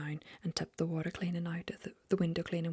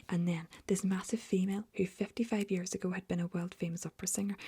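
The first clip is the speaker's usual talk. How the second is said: ordinary delivery, nothing marked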